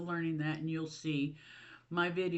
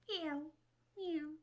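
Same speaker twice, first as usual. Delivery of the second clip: high little imitated meows, softer; mock cat meow; 8 semitones higher, 6 dB quieter than usual. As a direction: mimic the kittens begging. Imitating the cats meowing.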